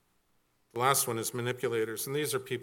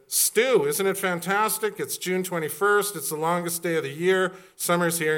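much higher and louder, gushing; mock excitement; 6 semitones higher, 7 dB louder than usual. Telling Stu about the longest day, hammed-up excitement. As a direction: beam about summer being here. Retelling his cheer to Stu.

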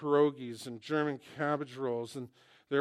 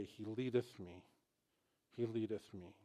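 soft reverent quote; 4 semitones lower, 10 dB quieter than usual. Acted. Preacher quoting the hymn to congregation, soft and reverent. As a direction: say it like a quiet vow.

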